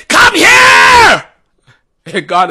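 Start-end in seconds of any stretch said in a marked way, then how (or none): 0.1-1.2 high, loud booming command; a mock bullhorn call; bullhorn shout. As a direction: blast it like through a bullhorn. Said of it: "listening for the bullhorn".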